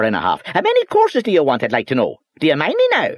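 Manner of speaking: high, loud scolding Northern Irish voice; angry little boss. Acted. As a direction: tear into him from behind the desk. Boss berating clerk.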